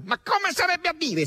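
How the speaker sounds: very high, exasperated outburst; nearly furious. A narrator's reading. Asasello rounds on Margherita, almost furious. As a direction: erupt in high-pitched indignation. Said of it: "si infuriò quasi Asasello".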